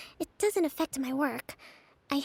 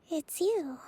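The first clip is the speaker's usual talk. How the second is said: ordinary delivery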